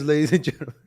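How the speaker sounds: laughing through the words; real laughter bubbling into the sign-off. Real, not acted. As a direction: chuckle while addressing the audience. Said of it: "I'm laughing 'cause".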